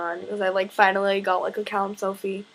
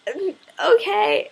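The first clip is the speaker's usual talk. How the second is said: squeaky overwhelmed okay; 10 semitones higher, 4 dB louder, slower than usual. Retold or imitated. Her reaction to star.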